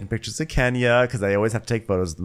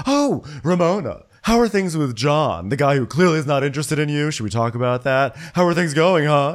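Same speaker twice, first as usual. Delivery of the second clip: hammed-up pointed prying; mock needling; 7 semitones higher, 5 dB louder than usual. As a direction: pile on exaggerated concern to embarrass her. Luann needling Ramona, acted out.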